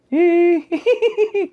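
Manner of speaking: high cackling titter; crying turned into a high giggle, imitated; imitated ghostly giggle. Storyteller imitating the crying that turns to laughter, high cackle.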